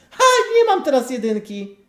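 loud, high, sudden excited yelp; excited outburst. Real, not acted. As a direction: blurt it out, thrilled and loud. Sudden outburst during game.

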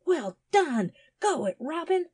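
high, excited cheer; whooping encouragement, pitched well up. A narrator's reading. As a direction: cheer the dog on. Boy cheers on fighting dog.

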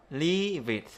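"leave it" slowly joined as "lee-vit"; slow, linked LEAVE IT; linked sounds. Shown as a demonstration. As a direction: carry the v of LEAVE onto IT, drawn out. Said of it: "chúng ta sẽ nối vào it".

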